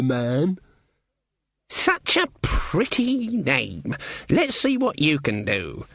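high, slow mock-sweet crone; sweet as poison; patronising old witch voice. Granny voiced.

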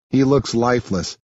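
ordinary delivery

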